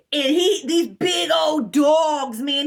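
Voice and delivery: ordinary talk, nothing marked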